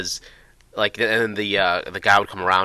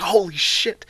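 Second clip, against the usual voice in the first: high, startled yelp of shock then a breathy laugh; 9 semitones higher, slower than usual. Real, not acted. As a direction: jump at a hand on your back. Felt hands from behind.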